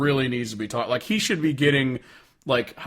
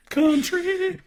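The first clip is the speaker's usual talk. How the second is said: mock drill sergeant. Tags song genre after impression.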